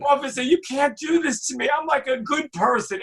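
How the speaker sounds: high, pleading mock crying; hammed-up tearful plea. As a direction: whine it like a caught kid begging. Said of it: "I start crying".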